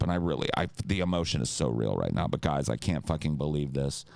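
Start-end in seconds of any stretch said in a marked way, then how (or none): none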